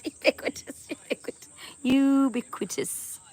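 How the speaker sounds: "ubiquitous" whispered, then slow and drawn out; careful ubiquitous. Shown as a demonstration. Streamer sounding out "ubiquitous" slowly for a viewer. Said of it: "trying to pronounce a word".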